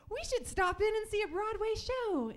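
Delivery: put-on girl in the car chiming in; eager kid. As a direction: chirp it like an excited kid. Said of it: "And then I said".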